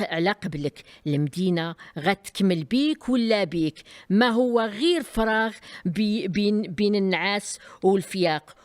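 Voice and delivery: usual speaking voice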